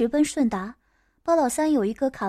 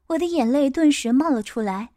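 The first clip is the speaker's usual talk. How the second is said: plain reading voice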